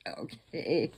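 amused reaction breaking into laughter; laughing. Real, not acted. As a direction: say it through a wheezy giggle. Teller cracks up at odd line.

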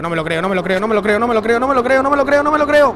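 repeated, climbing to a high scream; frantic disbelief. Real, not acted. Disbelief after the attack.

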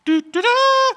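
high, loud vocal trumpet fanfare; trumpet impression. Shown as a demonstration. Pastor shows children his own trumpet sound, high trumpet toots. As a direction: toot it out like a trumpet, last note held. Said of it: "give you mine first".

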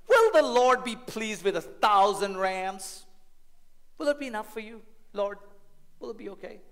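drip sarcasm on every question; sarcastic lawyer. Acted. Lawyer taunting the Lord, mock-earnest. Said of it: "a little bit of sarcasm here".

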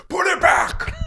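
imitating the partner's command; mock yell. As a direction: bark the order in a put-on voice. Partner quoted snapping at him.

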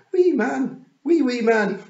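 put-on high, loud toddler chant; delighted little boy. Wentworth amused by the tiny man, gleeful toddler shout. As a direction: crow it gleefully like a small child.

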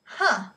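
loud scornful huh; disgusted huh. A narrator's reading. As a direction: scoff in disgust. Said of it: "in disgust".

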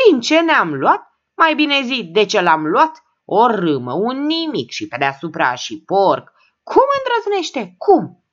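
raging. A narrator's reading. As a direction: tear into the absent husband. Daughter rages at husband.

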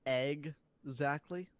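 Egg-zactly drawn out very slowly with EGG stressed; egg pun. Shown as a demonstration. Punning on exactly for the egg joke, slow. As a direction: stretch Egg-zactly, lean on egg. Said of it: "joke would have been".

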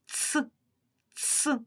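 short, soft Ц, said twice; two separate Ц sounds with a pause between; isolated sound Ц. Shown as a demonstration. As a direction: sound out Ц alone like a brief ts. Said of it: "Вимовимо перший звук у цьому слові".

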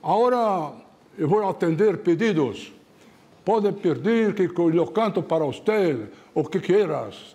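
slow, drawn-out stage announcement. Retold or imitated. Star singer telling the audience he takes requests, slow showman's imitation. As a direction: imitate a Mexican crooner offering requests. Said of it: "o Pedro Vargas falou assim".

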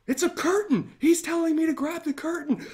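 light bulb goes on; excited discovery; sudden realization. Retold or imitated. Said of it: "And I'm thinking, gasps".

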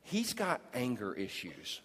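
low, soft wife complaining; a hint of the wife's complaint; wife reports husband's anger, lightly voiced; mock complaining wife. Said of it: "the girl would be like".

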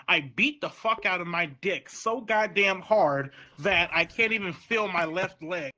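usual speaking voice

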